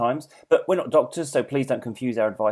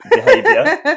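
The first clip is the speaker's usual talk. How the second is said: laughing; 12 dB louder, much slower than usual. Real, not acted. Laughing at own awkwardness.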